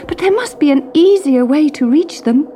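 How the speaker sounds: high, complaining princess; cartoon princess. A narrator's reading. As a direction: whine it breathlessly.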